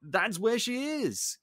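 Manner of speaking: high-pitched, helpless insistence; acted flustered protest; Maurice. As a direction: plead to be believed. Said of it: "Maurice is like".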